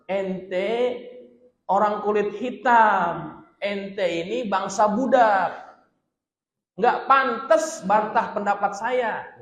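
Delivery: loud, high, slow and scornful; proud noble scorning a former slave, loud and slow; arrogant contempt. Acted. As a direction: look down on him and bark it out. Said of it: "begitu kira-kira".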